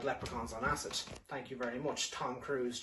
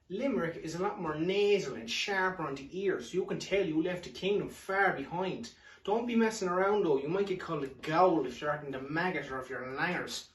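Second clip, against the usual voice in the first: ordinary delivery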